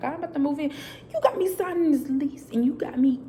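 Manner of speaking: high-pitched plea to God; high, put-upon complaint; retold protest. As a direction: protest it incredulously, pitched well up. Retells her protest to God.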